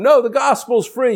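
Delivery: high-pitched protest, NO leaping up; mock objection. Acts out a listener's objection.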